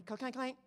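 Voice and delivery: quick mimicked door-lock noises; mock clanking sound effect; imitated lock clanks. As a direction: clack it out like car locks shutting. Imitates mom locking car door.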